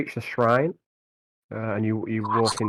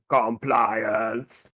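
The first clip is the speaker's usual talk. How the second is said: servitor voice; 2 semitones lower than usual. Acted. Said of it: "which keeps going".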